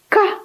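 short high rooster note; crowed syllable. A narrator's reading. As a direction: crow one syllable to be copied. Rooster crows next syllable.

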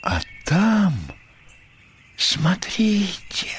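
slow, high, whispery gasp of awe; awed near-whisper; hushed wonder. A narrator's reading. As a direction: breathe it out slowly in amazement. First, hushed look upward.

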